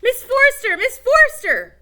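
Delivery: very high, very loud calling; shouting. Acted. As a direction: bellow her name at top of lungs. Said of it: "shouted at the top of their lungs".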